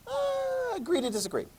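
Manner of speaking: high, soft unsure "uh" then a mild shrug; a hint of a pope shrugging it off; mock shrugging pope. Teacher mocks pope brushing off a critic, soft and unsure. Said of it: "does the Pope just sort of go".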